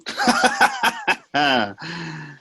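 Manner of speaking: a high, cackling laugh at his own joke; Satan cracking up at his own wordplay, cackling; gleeful laugh. Acted. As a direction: laugh hard at the pun.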